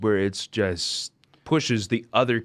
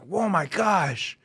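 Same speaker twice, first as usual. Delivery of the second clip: high, slow, stretched-out amazement; retold awe; 10 semitones higher, slower than usual. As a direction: re-enact being stunned, drawing it out. Re-enacting amazed reaction.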